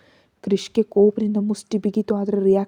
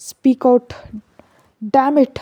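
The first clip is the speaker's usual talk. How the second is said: louder, higher angry shout; furious shout, louder and higher; 4 semitones higher, 6 dB louder, much slower than usual. A narrator's reading. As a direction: yell it at her. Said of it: "ಕಿರ್ಚ್ದ".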